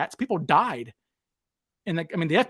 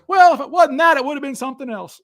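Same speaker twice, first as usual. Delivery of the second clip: higher, louder put-on old con voice; Mac's retort; 8 semitones higher, 6 dB louder than usual. Mac answers Bill on stage, higher put-on retort. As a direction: toss it off like a wry old con.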